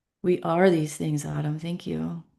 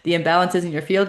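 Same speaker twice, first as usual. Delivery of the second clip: ordinary talk, nothing marked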